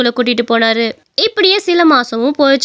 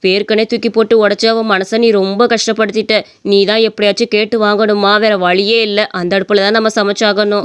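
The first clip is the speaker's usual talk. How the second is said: low-pitched reproachful elder; mother-in-law, insistent; 5 semitones lower than usual. Acted. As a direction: insist firmly in a lowered voice. (தாழ்ந்த குரலில் வற்புறுத்தும் மாமியார்)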